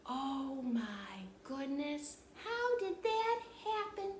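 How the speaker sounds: slow, breathless amazement; awed wonder. Shown as a demonstration. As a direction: show how wonder takes your breath. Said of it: "It leaves you with a feeling of".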